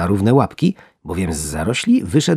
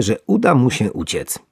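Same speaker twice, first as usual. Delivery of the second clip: usual speaking voice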